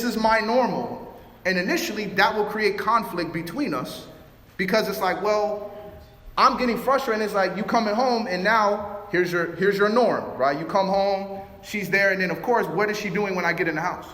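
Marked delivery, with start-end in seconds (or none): none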